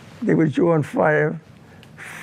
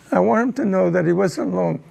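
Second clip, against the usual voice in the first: normal talking voice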